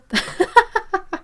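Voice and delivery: sudden high-pitched laugh; high burst of laughter. Real, not acted. Laughing at a place name spelling, high laugh.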